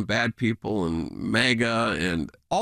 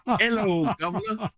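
higher, hammed-up imitation of the friends' greeting; mock hearty greeting; 8 semitones higher than usual. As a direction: call it out like the friends in the hotel lobby, half-joking. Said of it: "in the loudest voice imaginable".

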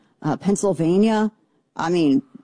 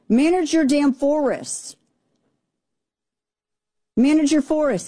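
pitched-up exasperated order to manage the forests; exasperated demand; 8 semitones higher than usual. Real, not acted. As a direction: spell it out like a scolding parent. Angry rant at Canada.

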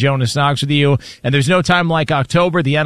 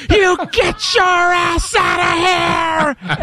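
slow, drawn-out shout, much higher; dad's shout; much slower than usual. Retold or imitated. Son imitating his dad screaming at the old dog, high and slow. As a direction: bellow it at the dog, stretched long. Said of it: "and he just goes".

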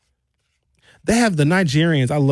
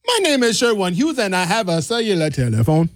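Nigerian accent; 3 semitones higher than usual. Shown as a demonstration. Comedian's self-intro redone as Nigerian Sherwin, Nigerian accent. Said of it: "Nigerian Sherwin".

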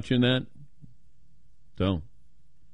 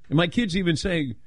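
ordinary talk, nothing marked

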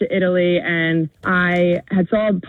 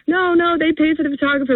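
retold flustered no, pitched way up; mock flustered protest; 10 semitones higher than usual. Caller retelling how she waved off her boyfriend, flustered high protest. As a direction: squeak out the protest like you're caught off guard.

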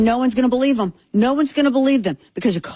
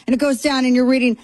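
ordinary talk, nothing marked

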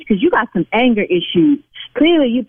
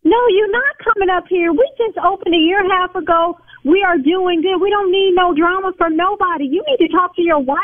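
heated scolding; 7 semitones higher than usual. Real, not acted. Owner scolding caller, still heated.

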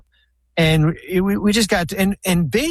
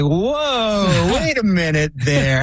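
high-pitched, drawn-out imitation of alarm; mock disbelief; 7 semitones higher, slower than usual. League balking at the billion-dollar pitch, in mock disbelief. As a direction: stretch the first words out high, imitating a stunned objection. Voices the league's startled pushback.

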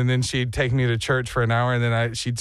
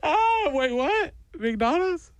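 high whimper turning to curious surprise; mock sobs cut short by interest; imitated crying kid; 11 semitones higher, much slower than usual. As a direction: play a bawling child suddenly distracted. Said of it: "I watched this kid immediately go".

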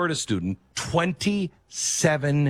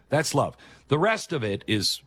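normal talking voice

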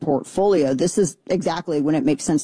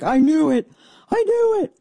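mock excited realization; 10 semitones higher than usual. Excited realization about alien website.

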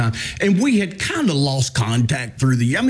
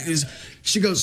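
normal talking voice

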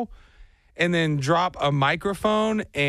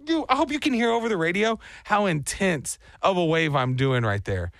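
ordinary talk

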